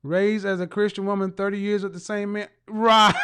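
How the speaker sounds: ordinary talk, nothing marked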